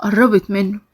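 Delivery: plain reading voice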